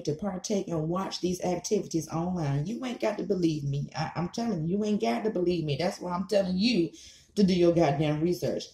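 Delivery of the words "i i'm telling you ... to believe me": ordinary talk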